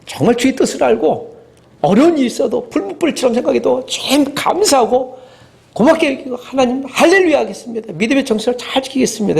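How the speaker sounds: pitched high, eager promise to God; a fervent new believer praying before the job; earnest hopeful prayer. Retold or imitated. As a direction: pray it like a believer full of hope. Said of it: "그리고 이렇게 하지 않습니까?".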